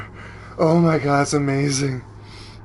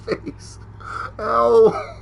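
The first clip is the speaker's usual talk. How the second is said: normal talking voice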